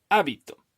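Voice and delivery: hábito with stress on HÁ, falling away; stress on first syllable. Shown as a demonstration. Teacher listing a tritonic word, hábito stressed on HÁ. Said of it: "Esa es una palabra tritónica".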